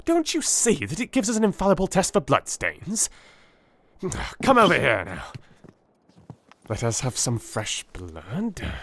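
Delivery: high, eager rush of explanation; eager Holmes. Acted. Holmes urging Watson to see the test's value, excited. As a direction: press the discovery on him excitedly.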